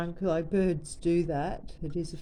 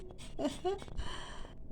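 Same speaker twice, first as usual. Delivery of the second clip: breathy laugh; 10 semitones higher, 10 dB quieter than usual. Real, not acted. Laughs at dancing bird.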